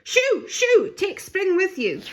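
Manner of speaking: very high, loud cries; shooing shout. A narrator's reading. Little bunny shooing singing birds, yelling. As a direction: shoo them off with all her might.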